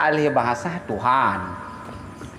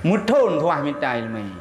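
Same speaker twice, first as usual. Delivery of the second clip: normal talking voice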